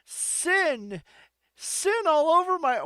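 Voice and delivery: high, loud mock outburst; excited woman. Woman answering McCready's talk of sin, hammed-up cry. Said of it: "She's like".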